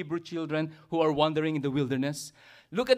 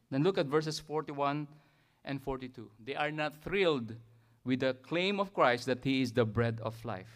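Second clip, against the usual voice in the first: usual speaking voice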